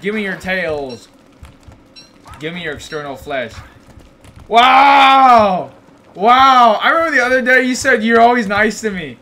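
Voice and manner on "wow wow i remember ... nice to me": loud, high indignant wow; mock outrage. Real, not acted. Streamer feigning outrage at a chat comment, loud and shocked. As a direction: act shocked and betrayed by a comment.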